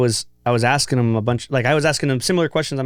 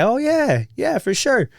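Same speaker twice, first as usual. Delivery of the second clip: much higher, breezy yeah yeah; mock casual agreement; 11 semitones higher, slower than usual. Podcast host mimics Ryan brushing off the question, high and breezy. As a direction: toss it off like a cool mentor.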